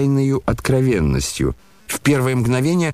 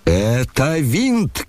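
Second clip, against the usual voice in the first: slow, higher-pitched exclamation; acted shout; 4 semitones higher, much slower than usual. A narrator's reading. The general denounces Ippolit, in a drawn-out acted shout. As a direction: draw out the accusation, pitched up. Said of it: "кричал генерал".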